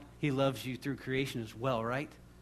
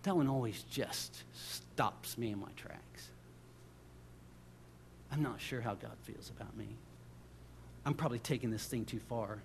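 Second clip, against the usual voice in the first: much softer, low confiding voice; hushed reflection; 6 semitones lower, 12 dB quieter than usual. Real, not acted. Preacher confiding doubts after a verse stops him, hushed and low. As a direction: drop right down, as if stopped short.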